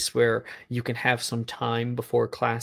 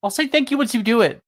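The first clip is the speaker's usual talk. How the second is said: teasing, high and fast; 11 semitones higher, 5 dB louder, faster than usual. Acted. Ed teasing the girl.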